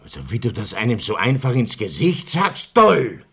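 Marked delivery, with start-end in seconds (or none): 2.8-3.2 loud. Acted. Outraged exclamation at the insult.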